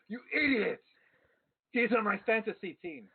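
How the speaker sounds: high, exasperated master's rebuke; acted indignant outburst; indignant scolding. Master berates servant after smack.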